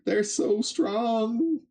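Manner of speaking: put-on very high, slow, starry-eyed child voice; awed kids. Kids marvelling at their parents, very high slow awe. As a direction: gush like an awed little kid.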